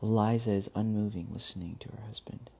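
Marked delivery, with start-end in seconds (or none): 1.3-2.5 whispering. A narrator's reading. Narration suddenly drops to whisper.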